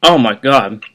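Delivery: strained, pinched voice fighting sourness; eating sour candy. Real, not acted. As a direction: squeeze the words out through a sour face. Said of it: "I'm clenching my fists really tight".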